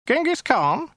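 high, thin alien voice; a small, prissy, weaselly official. Acted. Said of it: "in a small, weaselly voice".

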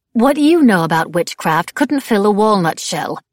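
loud, irritable put-down; Madame Labelle, irritable. A narrator's reading. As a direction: shoot down the remark with impatience. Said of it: "Madame Labelle said irritably".

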